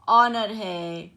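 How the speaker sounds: ऑनर drawn out slowly, opening on ऑ with no h; ऑनर said very slowly, silent h; slow teaching demo. Shown as a demonstration. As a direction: spell out ऑनर slowly for the class. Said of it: "जैसे ऑनरेबल word होता है".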